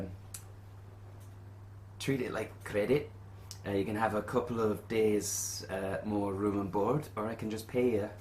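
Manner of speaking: lightly voiced quest-giver Willie; lightly put-on voice, lifted at phrase starts; innkeeper Willie. Innkeeper offering adventurers payment options, lightly voiced character.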